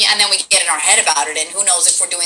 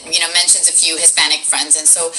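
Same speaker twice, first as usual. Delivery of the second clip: usual speaking voice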